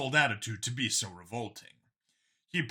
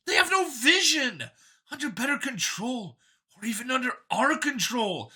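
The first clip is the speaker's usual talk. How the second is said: higher, louder, keen voice; a cheerful zealot pitched up; android copy, eager; 11 semitones higher, 4 dB louder than usual. A narrator's reading. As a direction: sell it like a proud believer. Copy rants about control.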